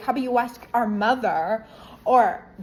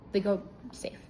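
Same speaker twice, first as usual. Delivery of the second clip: whisper; 5 dB quieter than usual. Real, not acted. Whispered aside in story.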